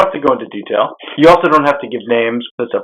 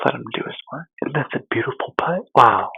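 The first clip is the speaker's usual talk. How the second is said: hushed golf commentator; 7 dB quieter than usual. Pretend. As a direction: keep it hushed while the golfer putts. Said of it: "like the Golf Channel when somebody's putting".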